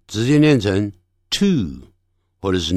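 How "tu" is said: "to" as plain too, no y glide; American pronunciation. Shown as a demonstration. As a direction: drop the glide in "to". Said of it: "一的音省略掉". (美式发音，to省掉一的音)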